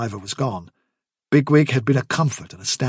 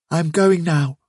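higher, sorrowful rabbit voice; sad Fiver; 5 semitones higher, slower than usual. Acted. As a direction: say it as a sad farewell. Fiver, sad, announces his departure.

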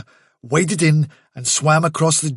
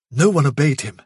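ordinary delivery, nothing marked

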